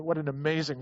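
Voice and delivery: ordinary delivery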